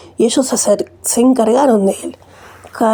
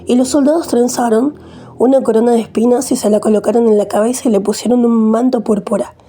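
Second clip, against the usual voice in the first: ordinary delivery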